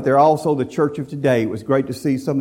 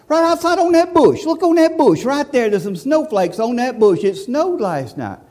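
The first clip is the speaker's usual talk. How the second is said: put-on high, pleading little kid; child's voice; 9 semitones higher than usual. A child tries to convince Daddy it snowed, high pleading voice. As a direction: squeak like an eager child pointing out snow. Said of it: "I said".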